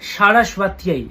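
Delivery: সরস্বত্যাই said slowly, syllable by syllable, with a long final "yai"; correct Sanskrit pronunciation. Shown as a demonstration. Astrologer correcting viewers' Sanskrit, সরস্বত্যাই slowly sounded out. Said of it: "সংস্কৃত উচ্চারণটা ঠিকঠাকভাবে জানতে হবে".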